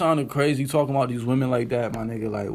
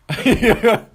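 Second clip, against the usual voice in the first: laugh; 6 semitones higher, 3 dB louder than usual. Real, not acted. Laughs at own mockery.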